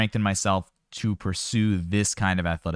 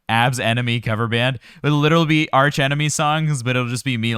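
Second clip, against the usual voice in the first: higher and louder, cracking himself up; laughing excited joke; 4 semitones higher, 7 dB louder than usual. Real, not acted. Coach joking to viewers about his workouts, excited and loud. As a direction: pitch the silly band name with glee.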